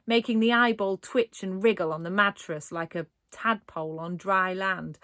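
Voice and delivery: usual speaking voice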